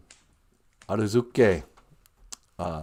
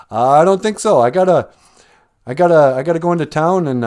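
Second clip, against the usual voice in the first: higher, louder, stumbling protest; hesitant refusal; 6 semitones higher, 8 dB louder, faster than usual. Acted. Jack turns down a goblin's trade, flustered. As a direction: turn the offer down, tripping over the excuse.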